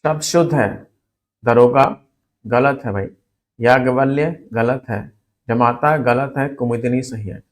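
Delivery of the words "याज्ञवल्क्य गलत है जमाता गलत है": normal talking voice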